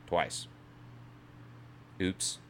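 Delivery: ordinary talk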